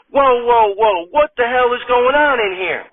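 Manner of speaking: startled at the doorway, push it up; stunned delivery woman demanding answers; alarmed shock. Acted. Pizza woman walks in.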